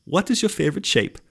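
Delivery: very high mock Dutch accent; worst Dutch accent. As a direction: ham up a terrible Dutch accent. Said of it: "that was the worst accent".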